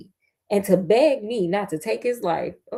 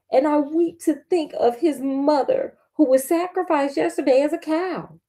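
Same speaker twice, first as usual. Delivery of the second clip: lightly voiced daughter, higher pitch; a hint of a higher young woman's voice; steward's daughter; 6 semitones higher than usual. A narrator's reading. Daughter explains the calf to her father, lightly voiced higher.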